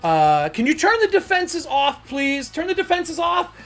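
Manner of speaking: a nervous, high-pitched inventor fumbling an apology; high flustered NPC gushing sorry; flustered artificer. Acted. GM voices flustered NPC pleading.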